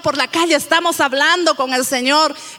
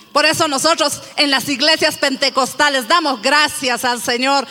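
ordinary talk, nothing marked

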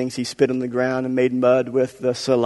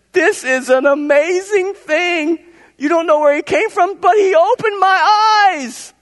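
very high, loud, drawn-out cry; exultant blind man; 6 dB louder, slower than usual. Acted. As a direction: cry out in astonishment at full pitch. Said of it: "I think that's how he said it".

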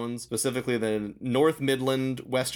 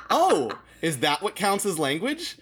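high, loud feigned realization; mock innocent; 8 semitones higher, 5 dB louder than usual. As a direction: play exaggerated puzzlement to trap a student. Acted gotcha reply to students.

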